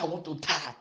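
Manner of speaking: ordinary talk, nothing marked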